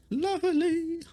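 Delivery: high, lilting lovely; high sing-song. Real, not acted.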